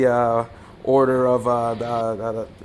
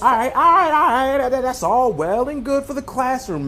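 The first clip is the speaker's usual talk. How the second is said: exasperated high voice; 10 semitones higher than usual. Acted. Impatient man cuts her off.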